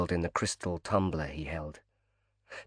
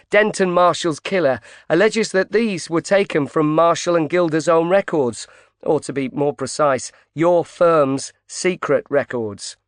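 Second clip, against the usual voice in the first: higher, brisk reporter pushing in; female reporter voice; 6 semitones higher, 3 dB louder than usual. Acted. Reporter Pope confronts grieving tycoon, pushy higher voice. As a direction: voice her pointed and eager.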